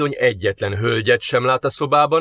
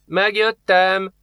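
bursts in high and slow, sing-song; ringing sudden call; 8 semitones higher, much slower than usual. A narrator's reading. Nadja bursting in on the demon, a ringing high call. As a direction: announce your arrival out of nowhere. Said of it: "váratlanul felzendülő hangjára".

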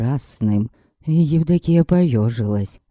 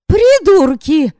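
high, loud and slow furious cry; angry girl; 6 dB louder, slower than usual. Acted. Yaska yelling at the jeering men, high and furious. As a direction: fling the insult back at them.

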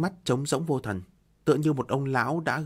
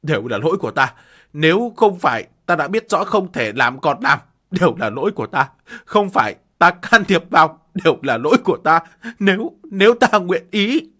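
louder, rising tearful cry; tearful self-blame; 4 semitones higher, 9 dB louder than usual. Acted. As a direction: blame yourself through tears. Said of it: "hàng lệ chảy xuôi".

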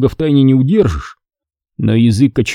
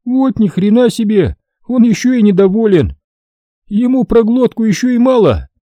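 a raised, needling Tajik soldier voice; Hasan objecting; 11 semitones higher than usual. A narrator's reading. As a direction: object with mock outrage as Hasan. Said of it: "возразил Хасан".

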